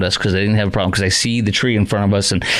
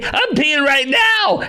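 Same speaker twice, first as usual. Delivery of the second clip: much higher, slow put-on voice; mock second passenger; much slower than usual. Host mimics the other woman on the plane, high and slow. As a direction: drag it out high like the other lady. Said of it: "And remember that other lady?".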